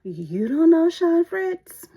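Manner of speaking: high, slow, loud disbelief; incredulous coworker. Retold or imitated. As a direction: drag out know in shock.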